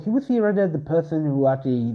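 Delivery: plain reading voice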